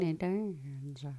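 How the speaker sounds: breathy whispered "Dancer". Acted. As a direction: hush it like a secret song line. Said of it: "Call me Tony".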